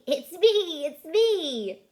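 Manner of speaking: high, loud piggy voice. Acted. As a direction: squeal it like a pig. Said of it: "Says the pig".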